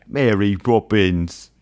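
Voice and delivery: slow, drawn-out mock Cockney; exaggerated slow Cockney. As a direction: stretch both words in a bad Cockney accent.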